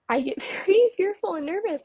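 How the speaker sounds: apologetic nerves, short of breath; fearful and nervous. Real, not acted. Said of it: "it takes my breath away".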